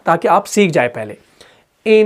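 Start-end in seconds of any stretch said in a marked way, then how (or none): 1.9-2.1 इन held long with the tongue tip on the palate; held nasal n. Shown as a demonstration. Teacher stretches the sound for learners. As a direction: hum the n of इन long. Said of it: "इसको लंबा खींच कर देखें आप".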